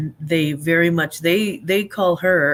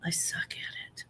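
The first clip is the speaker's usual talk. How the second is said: whisper; 15 dB quieter than usual. Real, not acted. Confessing she can't do accents.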